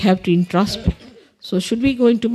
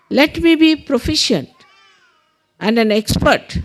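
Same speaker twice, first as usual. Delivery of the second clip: deliberate prayer leader with long pauses; measured meditation instructions with space to follow; guided meditation; 4 semitones higher than usual. Real, not acted. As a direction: guide them through it, phrase by phrase. Leads affirmations in guided meditation.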